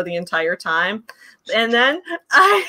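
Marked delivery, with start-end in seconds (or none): 1.5-2.7 giggling as she speaks; dissolving into giggles; laughing. Real, not acted. Starts laughing mid-story.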